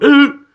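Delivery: high, loud gasped ooh; a sudden squeaky ooh after a laugh; mock gasping ooh. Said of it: "I laughed and then went".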